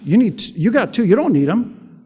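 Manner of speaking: usual speaking voice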